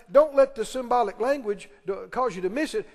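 ordinary talk

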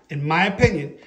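ordinary delivery

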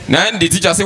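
usual speaking voice

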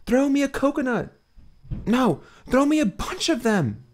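put-on high, urgent young woman; Munera's voice. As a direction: call out for a coconut.

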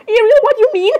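high, loud incredulous question; play-acted startled protest; mock alarm. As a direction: call her out in pretend shock. Friend reacts to secret in play.

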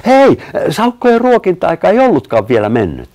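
high, loud call of discovery; excited exclamation. A narrator's reading. Narrator voices someone spotting the otters being fed, excited exclamation. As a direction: pipe up loudly, pitched high. Child spots feeding, exclaims.

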